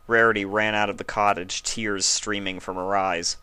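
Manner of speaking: usual speaking voice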